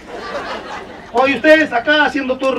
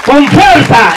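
loud, raised hype-man call; rousing the crowd; 4 semitones higher, 4 dB louder than usual. Real, not acted. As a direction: whip the crowd into big applause. Comedian whipping up applause from crowd. (animando al público a todo pulmón)

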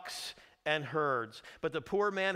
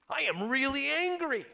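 high, loud declaration of anger; angry king; 10 semitones higher, 4 dB louder than usual. Acted. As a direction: announce the anger like a furious king. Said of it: "David says".

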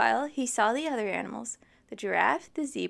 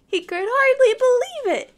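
excited narration; 12 semitones higher, 9 dB louder than usual. Acted.

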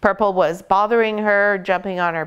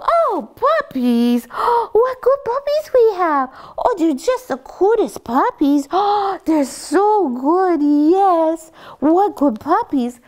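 high, drawn-out cooing; acceptable puppy gushing; 11 semitones higher, slower than usual. Shown as a demonstration. Trainer showing a fine tone for puppies, high slow coo. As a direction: coo slowly and high, without squealing. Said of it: "that sort of tone is fine".

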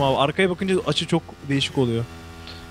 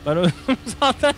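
higher voice breaking into laughter; laughing; 6 semitones higher than usual. Real, not acted. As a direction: get the words out through laughter. Laughs at own death. (gülmekten zor konuşarak)

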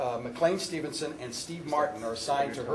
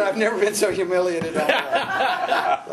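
slow, loud, laughing mock indignation; laughing as he quotes the humiliated actor; laughing mock outrage; 4 semitones higher, 5 dB louder, slower than usual. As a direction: choke it out through laughter. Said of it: "He said".

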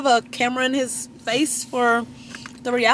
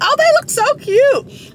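high squeal; slower than usual. Retold or imitated. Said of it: "I'm like".

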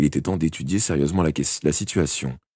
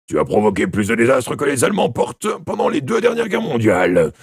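loud, raised indignant character voice; Snape piling on; 6 semitones higher, 5 dB louder than usual. A narrator's reading. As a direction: pile on the blame, high and forceful. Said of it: "renchérit Snape".

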